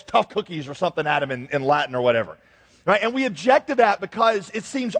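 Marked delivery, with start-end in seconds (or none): none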